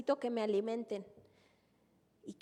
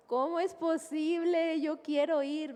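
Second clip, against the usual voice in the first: a hint of crying, higher and slow; tearful plea; 6 semitones higher than usual. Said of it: "lloraba y decía". (con un dejo de llanto, suplicando)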